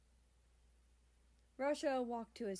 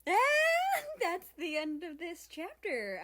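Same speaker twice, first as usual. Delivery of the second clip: much higher, much louder, slow outro cry; excited outro; 10 semitones higher, 11 dB louder, slower than usual. Real, not acted. Reader signing off to listeners, excited. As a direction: stretch it out as an over-the-top sign-off.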